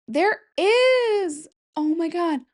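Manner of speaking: high, excited exclamation; delighted discovery. Real, not acted. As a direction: burst out high as you find it. Just found the cartoon exists.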